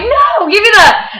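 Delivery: high, loud and slow insisting; demanding the pick back from boyfriend, high and loud; insistent demand. Retold or imitated. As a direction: refuse to back down. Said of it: "we got into a huge argument".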